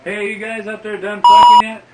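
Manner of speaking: loud high shout to the front seat; girl shouting at the couple up front, loud; calling out. Retold or imitated. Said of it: "she goes".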